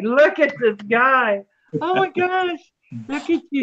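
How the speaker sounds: high, delighted cooing. Real, not acted. Grandmother greeting the host's baby son, cooing baby talk. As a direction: coo at the little one, pitch swooping up.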